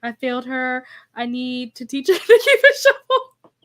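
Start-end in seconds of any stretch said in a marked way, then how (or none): 2.1-3.5 loud, breaking into laughter; real laugh through the words; laughing while talking. Real, not acted. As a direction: lose it laughing as the sentence ends. Laughing over the funny dance video.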